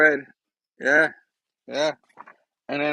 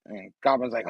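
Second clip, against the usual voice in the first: plain reading voice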